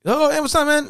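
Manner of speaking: high, breezy voice of a clueless guy; casual greeting. Retold or imitated. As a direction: act like nothing is wrong.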